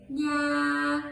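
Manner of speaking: "Yeah" drawn out, half-willing; a grudging, stretched "Yeah"; reluctant yeah. Shown as a demonstration. Teacher showing an unwilling yes: reluctant "Yeah". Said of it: "I'm willing to, but I don't really want to".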